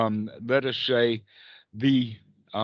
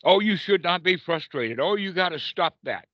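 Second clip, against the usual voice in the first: higher, scolding tone at oneself; scolding self-talk; 6 semitones higher than usual. Retold or imitated. Teacher imitating a meditator scolding himself, higher and stern. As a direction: tell yourself off sharply. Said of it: "the one who struggles against it".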